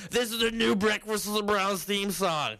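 slow, high hammed-up announcement; mock announcer. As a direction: announce it like a grand joke. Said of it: "Like, like".

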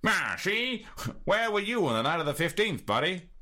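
tough detective. Shown as a demonstration. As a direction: push him hard like a cop. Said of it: "There's gonna be a few of these".

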